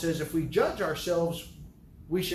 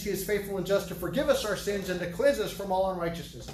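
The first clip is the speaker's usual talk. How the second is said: ordinary talk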